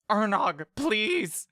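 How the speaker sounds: high, pleading cry of the name; Mock pleads with the dying brother; desperate plea. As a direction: beg him not to stay behind. Begging the brother.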